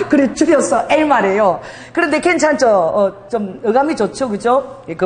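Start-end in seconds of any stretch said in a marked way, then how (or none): 0.0-1.6 airy laugh, then slow amused words. Real, not acted. Laughs at nickname.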